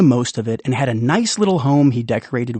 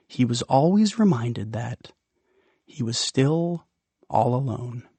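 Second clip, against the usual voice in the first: slowing, softer, sadder; wistful, fading; 5 dB quieter than usual. A narrator's reading. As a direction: let the loneliness sink in. Sad end of the night.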